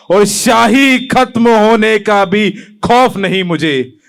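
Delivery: slow, spaced-out declamation; measured poem recitation. Acted. Poet vowing he has no fear, measured. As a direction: draw the line out deliberately. First take of repeated verse.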